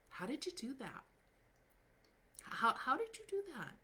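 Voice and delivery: high-pitched, astonished how did you do that; amazed high question. Retold or imitated. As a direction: play an awestruck admirer who can't believe it. People amazed by the results.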